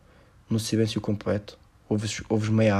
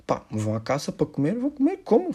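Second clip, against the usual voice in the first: mock hunter; 6 semitones higher than usual. Mocking hunters who eat prey.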